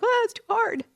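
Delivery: high-pitched mimicked protest; mock complaint. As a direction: complain in a put-on high little voice. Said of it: "and you're like".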